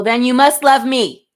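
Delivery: loud, cheeky brag; sassy loud quip; playful boast. Real, not acted. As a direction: tease them with mock vanity.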